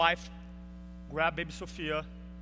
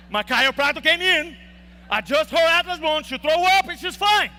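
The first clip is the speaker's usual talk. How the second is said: same answer again, higher and louder, insisting; emphatic repeat; 6 semitones higher, 8 dB louder than usual. Retold or imitated. Repeating the answer to doubting nurses, loud and insistent. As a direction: hammer the story home, loud and high.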